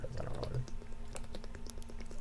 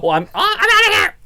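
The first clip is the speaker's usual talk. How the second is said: high, loud, whiny falsetto; whiny girl voice; 11 dB louder, faster than usual. Retold or imitated. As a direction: squeal it like a spoiled girl. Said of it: "Everybody's so".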